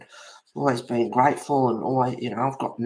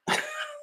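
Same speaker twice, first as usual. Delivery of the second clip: high squeaky laugh; 4 dB quieter than usual. Real, not acted. Laughing at the situation.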